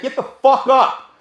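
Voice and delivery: loud, high, aggressive order; retold angry command. Fighter ordering downed man up, acted angry shout. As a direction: re-enact yelling at a man on the floor. Standing over man he hit.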